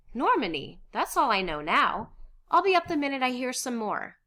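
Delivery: loud, eager reply; excited. Acted. Miep about to hurry out, excited. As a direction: promise it in a happy rush.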